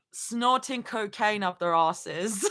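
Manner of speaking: slow, deliberate Australian attempt; Australian accent. Shown as a demonstration. Streamer repeating the comment for laughs, slow Australian accent. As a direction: draw it out in a put-on Australian accent. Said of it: "Imagine if suddenly Zoe turned Australian".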